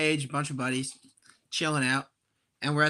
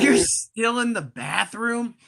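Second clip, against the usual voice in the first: slow, stretched incredulous retort, louder on "You're not even"; mock disbelief; 5 semitones higher, 4 dB louder, slower than usual. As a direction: sneer back at the attendant in disbelief. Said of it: "in my head, I'm like".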